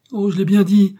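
high, loud wail of dismay; distraught outcry. A narrator's reading. Sarah lamenting over Millicent, crying out. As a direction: cry out in distress. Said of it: "s'écria Sarah en se tordant les mains".

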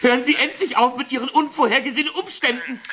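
high, loud, fast exasperated outburst; furious high-pitched rant at the blackmailer; shrill outburst. Acted. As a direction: blow up at the caller, voice cracking high. Victim explodes at blackmailer on phone.

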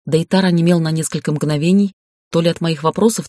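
plain reading voice

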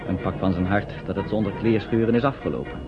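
ordinary talk

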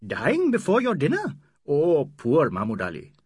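a lightly voiced, teasing sympathy; mock pity. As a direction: poke fun at a starving friend. Aziz mock-pities his friend.

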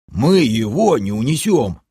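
mildly indignant protest in a character voice; a touch of indignation; indignant gnome. A narrator's reading. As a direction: object as an outraged gnome, lightly voiced. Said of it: "возмутился гном".

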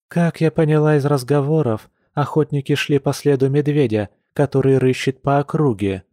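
ordinary delivery, nothing marked

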